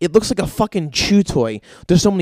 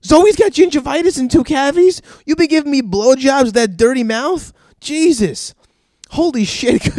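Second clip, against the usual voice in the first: much higher and louder, shocked yelling; shocked outburst; 10 semitones higher, 7 dB louder than usual. Retold or imitated. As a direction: blurt it out in disbelief. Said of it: "And I'm like".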